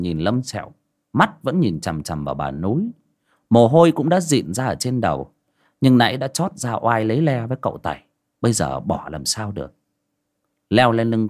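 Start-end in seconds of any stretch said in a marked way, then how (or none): none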